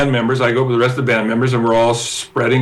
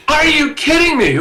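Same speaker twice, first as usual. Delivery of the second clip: disbelief; 14 semitones higher than usual. Retold or imitated. Disbelief on stage.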